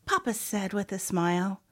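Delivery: usual speaking voice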